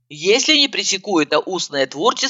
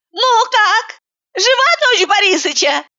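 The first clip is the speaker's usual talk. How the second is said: bright, much higher put-on voice of a cheerful cook; cheerful question; 3 dB louder than usual. A narrator's reading. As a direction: ask it gaily, as if about cockroaches. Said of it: "весело поинтересовалась она".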